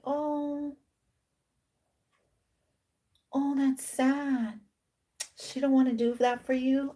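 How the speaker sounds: mock sympathy. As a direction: imitate a temptress pitying him, soft and drawn out. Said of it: "she could have been telling you".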